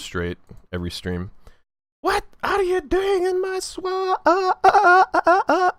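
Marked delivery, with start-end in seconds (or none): 2.0-5.7 very high, squeaky cartoon voice; high squeaky meme voice. Repeats swamp meme.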